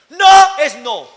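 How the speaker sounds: loud and slow, each word hit; forceful no means no; slow emphatic shout. Real, not acted. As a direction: bark the rule out slowly. Preacher stressing no means no.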